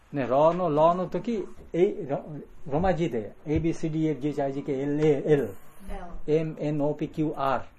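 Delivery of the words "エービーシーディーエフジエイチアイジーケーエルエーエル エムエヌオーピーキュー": fast rattled alphabet recital. Shown as a demonstration. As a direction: run through the letters quickly.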